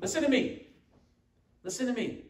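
lightly voiced mother calling her kids to listen; imitating a mom. Preacher to congregation, lightly imitating a nagging mom. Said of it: "doesn't that sound like parents, like a mom".

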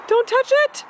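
sharp gasp then loud high "Don't touch it!"; alarmed warning. Real, not acted.

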